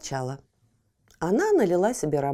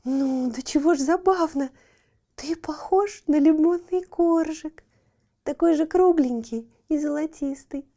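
tender; 6 semitones higher than usual. Acted. Cat admires the puppy.

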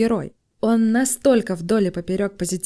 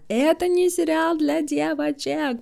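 higher, slow mock-serious quote; mocking hater quote; 8 semitones higher, slower than usual. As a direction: drawl it like a smug hater. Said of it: "не хочу обесценить комментарий хейтера".

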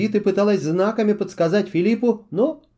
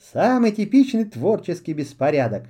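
normal talking voice

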